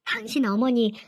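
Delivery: ordinary delivery, nothing marked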